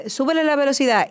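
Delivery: acted rehearsal request. Singers asking the pianist to speed up, acted request. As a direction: call it out to the pianist.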